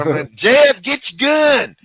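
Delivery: high, loud hammed-up Southern belle; shrill over-the-top Southern lady calling out; Hollywood Southern accent. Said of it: "the Hollywood version of the South".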